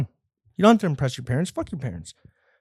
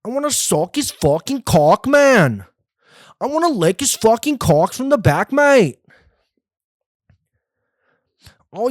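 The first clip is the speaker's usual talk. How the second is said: high, camp mock British voice, louder on "mate"; mock British accent; 8 semitones higher, 4 dB louder than usual. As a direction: ham up a camp London lad.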